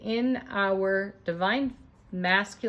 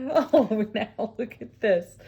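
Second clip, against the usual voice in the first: laughing; 3 dB louder, slower than usual. Real, not acted. Thrilled by cards falling out.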